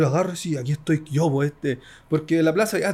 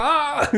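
high mock yell, tossed off with a laugh; imitated crowd yell; 11 semitones higher than usual. Imitating the fans at the back yelling too. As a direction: yelp it like someone in the back. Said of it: "y está ahí atrás".

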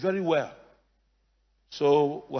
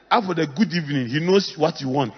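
ordinary talk, nothing marked

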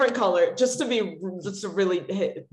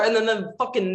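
usual speaking voice

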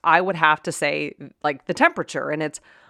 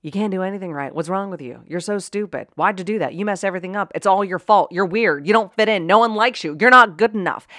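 put-on berating a kid. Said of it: "someone was saying that stuff to your kid".

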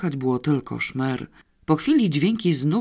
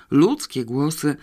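ordinary delivery

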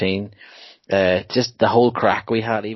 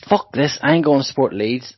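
retold outburst; 4 semitones higher, 6 dB louder than usual. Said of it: "And he goes".

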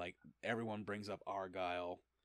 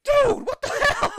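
much higher, loud laughing yell; shocked shout; 18 dB louder than usual. Retold or imitated. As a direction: squawk it in shock. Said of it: "Batman was like".

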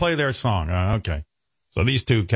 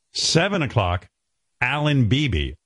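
ordinary delivery

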